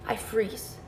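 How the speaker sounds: breathy whisper of a frozen moment. Real, not acted. Recalling freezing in fear.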